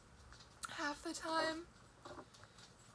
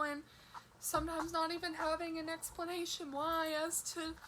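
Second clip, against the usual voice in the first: ordinary talk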